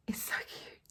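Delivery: breathy whisper of delight; whispered cute. Real, not acted. Cooing over her stitched Santa.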